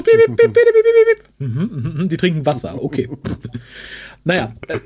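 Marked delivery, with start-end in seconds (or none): 0.0-0.4 high squeaky bleeping over words; censor bleep. Pretend. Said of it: "Piept das aus". 0.4-1.5 high squeaky censor beeps; quack censor. Pretend. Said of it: "Piept das aus".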